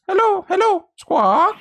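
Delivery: high parroting hello with a squawk. Acted. Parrot answering the defense lawyer, high bird voice. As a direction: chirp it like a talking bird.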